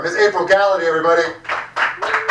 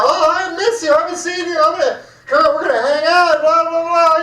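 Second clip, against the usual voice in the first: high, sugary mock-friendly gush; mocking gushing friends; 10 semitones higher than usual. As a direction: ham up the fake sympathy of friends who suddenly care. Mocking fair-weather Gentile friends.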